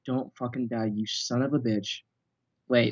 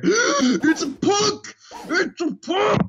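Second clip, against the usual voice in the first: excited shriek; much slower than usual. Real, not acted. Gasps at enemy in game.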